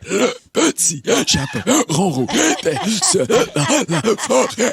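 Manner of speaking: words gasped on the in-breath; talking while inhaling. Pretend. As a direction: read it while sucking air in. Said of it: "un concours de lecture en parlant en aspirant".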